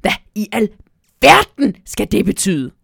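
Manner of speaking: loud, indignant elf shout; angry shout. Acted. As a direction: shout in outrage at being grabbed. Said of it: "råber Nisse Pok vredt".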